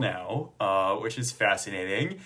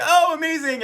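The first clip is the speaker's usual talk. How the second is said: much higher and louder delight; 5 dB louder than usual. Retold or imitated. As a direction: imitate the friend's thrilled reaction. Friend delighted by the news.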